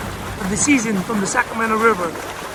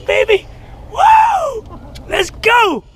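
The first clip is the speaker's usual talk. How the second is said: excited shout; 10 semitones higher, much slower than usual. Real, not acted. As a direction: holler with joy at the catch. Landing a fish, cheering.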